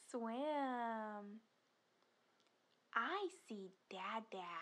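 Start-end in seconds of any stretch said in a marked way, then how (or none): 2.9-4.5 hushed, secretive "I see"; playful whisper. Pretend. Spotting dada in picture.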